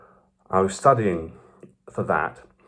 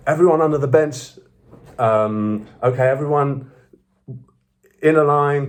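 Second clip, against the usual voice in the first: raised voice, higher, calling out orders; bossy student leader; 5 semitones higher than usual. Retold or imitated. Student leader ordering first-years around on introduction day, bossy. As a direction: imitate a leader marshalling a group of newcomers.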